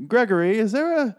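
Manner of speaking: high-pitched put-on wife voice; mock woman's voice. Wife asks her husband about a hidden passageway, mock high voice. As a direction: play the suspicious wife in exaggerated high pitch. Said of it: "she didn't talk like that. It's just the way I'm doing it".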